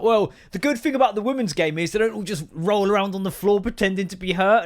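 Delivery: mock online commenter; lightly voiced smug argument; mocking quote. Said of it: "social media argument".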